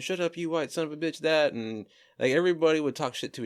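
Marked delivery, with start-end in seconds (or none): 0.0-1.5 higher-pitched imitation of coworker banter; mock comeback. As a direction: fire it back as a retold jab. Retold workplace trash talk.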